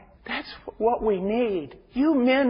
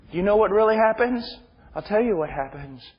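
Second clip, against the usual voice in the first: ordinary delivery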